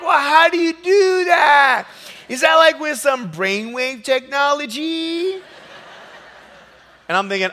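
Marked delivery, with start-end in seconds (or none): 0.0-5.4 high, loud, slow amazed shout; amazed passengers. Retold or imitated. Passengers asking how he flies, amazed shout. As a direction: ask it wide-eyed and astounded. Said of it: "They're like".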